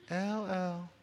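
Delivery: put-on soft, high child's far-away call; tiny high sing-out; little girl calling. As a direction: imitate a small girl calling a nickname from down the street. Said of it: "and she would say".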